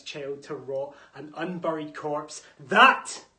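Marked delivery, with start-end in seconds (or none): 2.7-3.2 one word flung out loud; sudden outburst. Acted. Antigone to the king, bursting out loud. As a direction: hurl that word at him.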